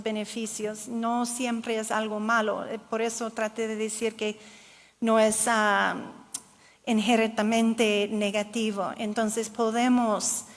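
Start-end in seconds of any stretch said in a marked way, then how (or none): none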